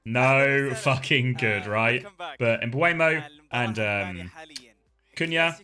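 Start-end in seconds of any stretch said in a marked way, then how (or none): none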